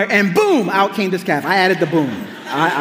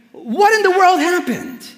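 high, incredulous Moses grilling his brother; outraged Moses; 11 semitones higher than usual. Retold or imitated. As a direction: pitch up, demanding answers in disbelief. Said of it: "He says to them".

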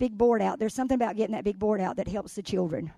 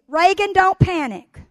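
higher and louder, "don't" hit hard; loud drill; 8 semitones higher, 9 dB louder than usual. Retold or imitated. Nana drilling her granddaughter, louder. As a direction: call it out firmly. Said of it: "And I'd say".

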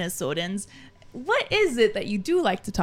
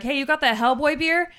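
eager; 7 semitones higher, 4 dB louder than usual. Retold or imitated. Drunk arguing at beer booth.

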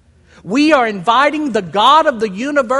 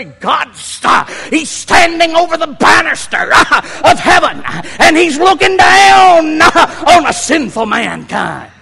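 higher, much louder hollering sermon; old-time preacher shout; 6 semitones higher, 8 dB louder than usual. Retold or imitated. Preacher imitating old timers to his congregation, hollering. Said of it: "the old timers used to say".

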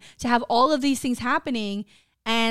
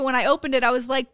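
normal talking voice

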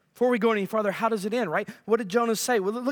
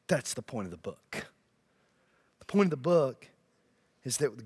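earnest hush; 10 semitones lower, 6 dB quieter than usual. Real, not acted. Sermon drops to earnest hush.

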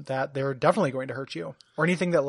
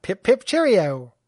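slow, louder hammed-up British cheerio; mock British; 4 semitones higher, 6 dB louder, slower than usual. Co-host answers the fake British host in a slow, louder mock British accent. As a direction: ham up a posh British toff. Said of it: "British version".

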